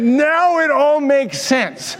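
high, slow, laughing through the words; laughing revelation. Real, not acted. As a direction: savour the joke as a grand discovery.

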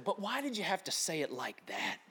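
soft, wounded volunteer voice; hushed mock reproach; imitated hurt complaint. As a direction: mimic a quiet, reproachful complaint.

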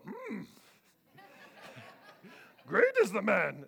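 lightly voiced Yoda with a hum; a half-laughing, badly faked Yoda; a hint of Yoda, laughing through it; terrible Yoda. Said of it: "that was a terrible Yoda".

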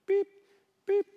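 short, high-pitched beeps; imitated scanner beep. Cashier keeps scanning groceries, high imitated beeps. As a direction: mimic a checkout scanner twice.

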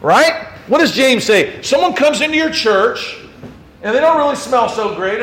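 ordinary delivery

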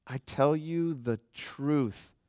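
very slow, measured and grave; Jesus answering the leaders, slow and solemn; solemn declaration. Retold or imitated. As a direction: lay each word down slowly.

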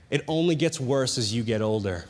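a hint of a wise old man. Old man warning the student in class, lightly voiced, low. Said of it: "this old man".

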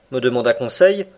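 plain reading voice

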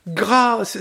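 put-on a cry for mercy from the old man; begging the young woman for mercy; supplicating old man; hoarse cry. A narrator's reading. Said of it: "s'écria celui-ci d'une voix rauque".

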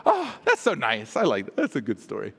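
cooing, then dropping low; hammed-up cosy approval; mock contentment. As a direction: play a complacently pleased reader. Mock contented reaction to the story.

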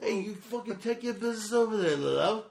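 high-pitched, badly faked Aussie; bad Australian accent. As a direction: send someone packing in hammed-up Australian. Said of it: "neither one of these two motherfuckers can do Australian".

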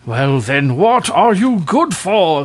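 high, loud furious cry; in a fury. Acted. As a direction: explode at him in fury. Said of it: "cried the king in a fury".

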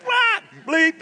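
very high, loud startled cry; shocked yelp. Retold or imitated. Disciple seeing Jesus on the water, a startled high yelp. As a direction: yelp like you just saw a ghost. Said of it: "this guy might have let a word slip".

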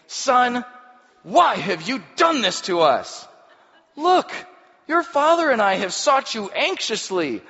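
high, loud, anxious mother's scolding; frantic mother. Acted. Worried mother scolding her son after searching for him. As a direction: let the mother's panic pour out.

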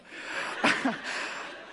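high, giddy breathy laughing; amazed laugh. Acted. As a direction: laugh helplessly in disbelief. Criminal laughs in amazed disbelief.

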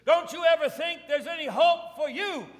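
high, loud preacher's appeal; urgent pulpit proclamation at full voice; full-force preaching. Real, not acted. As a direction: press the congregation with an impassioned challenge.

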